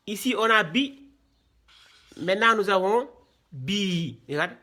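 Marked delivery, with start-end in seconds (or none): 0.6-1.1 "bi" with a brief i; quick, short "bi"; short vowel. Shown as a demonstration. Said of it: "les voyelles brèves". 3.5-4.2 "bi" with a drawn-out i; sustained "bi"; long vowel. Shown as a demonstration. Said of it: "C'est tiré".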